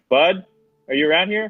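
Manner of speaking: shouting out. Acted. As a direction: call out searching for a friend. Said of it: "she's gonna shout".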